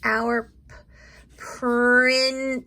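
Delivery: Principal drawn out slowly, sound by sound; sounding out principal slowly for young writers; stretched out. Shown as a demonstration. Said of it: "let me try and do that".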